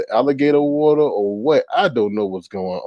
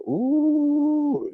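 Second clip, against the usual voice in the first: a squealed mock ooh, much higher; a shrill, teasing ooh; 4 dB quieter than usual. Retold reaction, sound.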